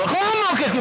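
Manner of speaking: high indignant mom outburst; stern mother. Retold or imitated. Mother snapping back at the lenient father, high and indignant. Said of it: "Y la mamá".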